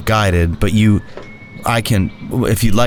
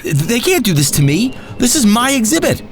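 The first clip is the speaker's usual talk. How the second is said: much higher, strained cry; desperate plea; 12 semitones higher than usual. Acted. Gary pleading in despair over losing his exhibit. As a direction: wail it in disbelief.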